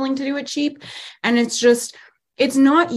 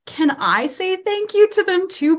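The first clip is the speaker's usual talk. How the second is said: high grateful dad voice; 10 semitones higher than usual. Retold or imitated. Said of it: "my dad was like".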